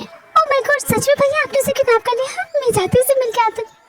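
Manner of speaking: a sister thrilled to hear the news; excited girl. Acted. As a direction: gasp with delight at the news.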